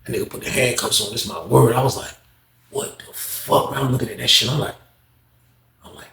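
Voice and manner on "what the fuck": slow, breathless whispered disbelief; stunned whisper. Retold or imitated. Onlooker whispering to himself at the arrest, slow. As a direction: barely get the words out.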